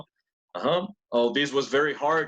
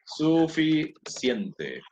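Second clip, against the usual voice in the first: drawn out; much slower than usual. Shown as a demonstration. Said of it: "And this means".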